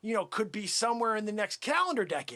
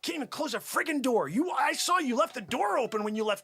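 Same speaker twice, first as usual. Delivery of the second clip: normal talking voice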